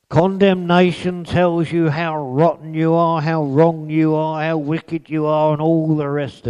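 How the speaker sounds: high, loud listing; preaching. Acted. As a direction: pound out each how. Preaching list.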